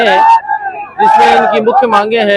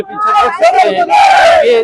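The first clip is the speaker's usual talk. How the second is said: shouting over crowd; 12 semitones higher, slower than usual. Real, not acted. Reporting over loud protest crowd.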